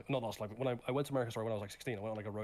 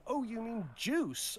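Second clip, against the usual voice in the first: slow, much higher, dim-witted question; mock clueless cashier; 12 semitones higher, much slower than usual. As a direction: play the blank cashier, drawn out. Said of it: "she was like".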